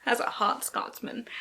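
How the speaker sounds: slow, breathy unvoiced tease; sultry whisper. Booktuber teasing a hot Scotsman love interest, sultry. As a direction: purr it suggestively.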